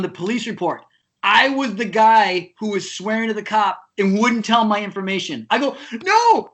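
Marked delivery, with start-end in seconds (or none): 6.0-6.5 high, loud retold objection; indignant protest. Wrongly named drummer objecting in court, indignant protest. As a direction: act out shocked denial.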